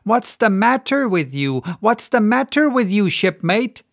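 landlady demanding, a touch alarmed. Acted. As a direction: press him for an answer. Landlady voiced.

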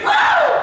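high shrieking squeal of fright. Retold or imitated. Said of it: "they would shriek".

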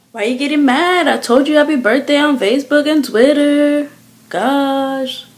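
mock defensive whine. Acted defensive friend in a quarrel.

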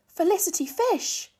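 high, loud call across the pond. A narrator's reading. Lucy calling to the fish jumping from the pond, loud and high. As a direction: call her name out across the water. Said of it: "called Lucy".

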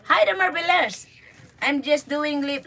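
usual speaking voice

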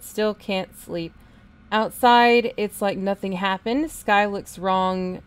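slow, loud diary reading; dramatic reading. Acted. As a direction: read the journal with weight. Reads holotape diary entry.

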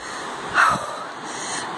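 long breathy tired sigh; heavy exhausted sigh. Real, not acted. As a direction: let out a worn-out sigh. Exhausted pause on steps.

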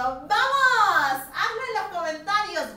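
loud, high push to answer; excited urging. Real, not acted. Teacher urging viewers to answer in the comments, excited. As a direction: fire up the class.

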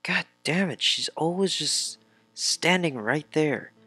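high-pitched exasperated complaint; exasperated whine. Real, not acted. Player griping at a game character always standing there, exasperated whine. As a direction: whine it high, fed up with her.

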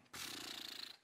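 unvoiced scornful puff of air; quiet mock scoff; dismissive pfft. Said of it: "him shaking his head going".

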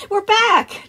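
put-on high, loud child's shout; excited call. A narrator's reading. Girl running up to her daycare attendant, excited call. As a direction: call out like an eager kid. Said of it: "Jess called".

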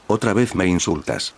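usual speaking voice

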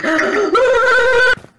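sharp high gasp; startled gasp. Real, not acted. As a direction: gasp in fright. She was behind me.

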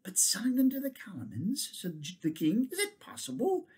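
soft breathless question; almost whisper it in shock; king asks unicorn in hushed horror; hushed disbelief. Acted.

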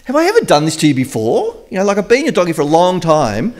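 high, pleading donkey voice; talking donkey. Acted. Donkey defending itself to Balaam, high and pleading. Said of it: "And the donkey says".

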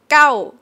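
gao as spelled but said long. Shown as a demonstration. Teacher showing the spelled form. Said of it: "So the spelling".

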